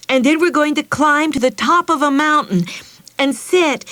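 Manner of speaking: high, loud, fast excited chatter; bubbling excitement. Acted. Meg tells Mother about the school picnic, excited. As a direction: gush it all out at once. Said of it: "she didn't stop talking".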